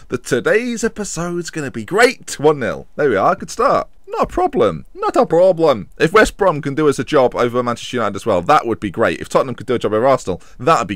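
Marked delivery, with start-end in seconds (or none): 4.1-4.9 high squeak. Real, not acted. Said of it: "repeats the phrase".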